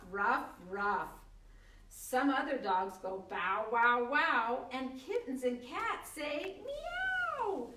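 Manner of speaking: animated read-aloud with animal noises; reading a picture book aloud, animated; reading to children. Acted. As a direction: voice each animal for a small child. Reads board book aloud.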